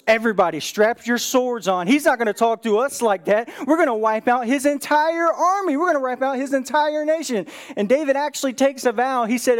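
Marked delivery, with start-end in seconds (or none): 0.1-7.5 higher-pitched, rallying war cry in retelling; angry. Retold or imitated. David rallies his men against Nabal, acted anger. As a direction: act out an outraged leader calling men to arms. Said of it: "David is angry".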